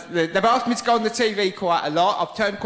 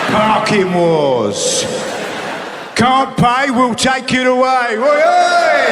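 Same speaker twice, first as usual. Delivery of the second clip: loud, higher and building to a shout; rowdy telly hype; 7 semitones higher, 7 dB louder, much slower than usual. Real, not acted. Comic listing his favourite rowdy TV shows, loud and excited. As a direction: hype it like a bloke who loves a kick-off.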